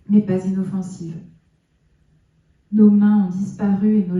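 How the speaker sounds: measured poem recital with long pauses; solemn public reading of a poem; poetry reading. Acted. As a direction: recite it line by line, letting each phrase stand. Reads own poems aloud.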